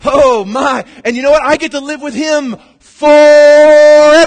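preacher exulting about living forever; joyful outburst. Real, not acted. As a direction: burst out with delight.

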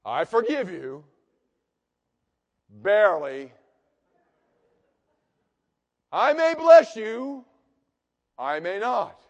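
slow, loud, begrudging divine voice; grudging God voice. Pretend. God speaking to you, mock grudging and slow. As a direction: play a reluctant, stingy God. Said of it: "the voice of God saying your name".

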